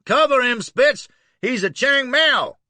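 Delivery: very high, loud alarmed yell; panicked Southern yell. Acted. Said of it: "leap three feet and yell".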